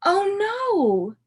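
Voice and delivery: high, loud mock dismay "oh no"; playful storybook alarm. A narrator's reading. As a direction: gasp it out to the kids in mock alarm.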